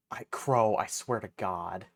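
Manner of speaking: usual speaking voice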